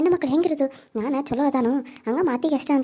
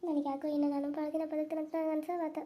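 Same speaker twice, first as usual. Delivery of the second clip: much softer, hushed private reflection; inner monologue; 12 dB quieter than usual. Acted. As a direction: think it aloud to yourself, barely voiced. Old woman's private inner thoughts.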